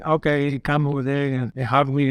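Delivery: ordinary delivery, nothing marked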